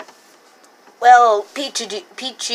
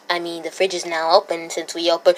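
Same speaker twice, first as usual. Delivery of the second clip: usual speaking voice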